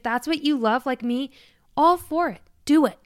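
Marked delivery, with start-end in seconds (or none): 1.8-3.0 suddenly higher and louder, urging; excited cheer. Real, not acted. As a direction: cheer them on to just do it. Urging listeners to do what they love.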